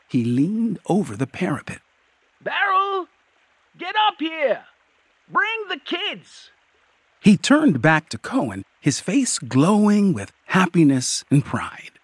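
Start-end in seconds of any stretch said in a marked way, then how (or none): 2.4-6.5 high, carrying call. A narrator's reading. Troll calling its wife up from below, high call.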